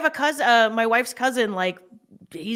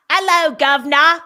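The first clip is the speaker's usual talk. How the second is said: louder, higher mock Cockney greeting; bad British accent; 4 semitones higher, 7 dB louder than usual. American mocking how terrible Americans do accents, bad British accent. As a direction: badly fake a chirpy British accent. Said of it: "we're just like".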